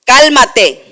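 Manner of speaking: Jesus rebuking the wind and sea; commanding voice. Retold or imitated. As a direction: rebuke the storm with authority. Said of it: "con voz de autoridad".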